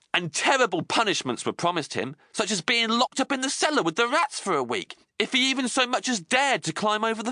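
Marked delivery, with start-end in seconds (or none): none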